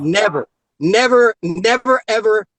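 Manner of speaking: NEVER hammered loud, over and over; emphatic never. Real, not acted. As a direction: pound the word never. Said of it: "emphatic repetition".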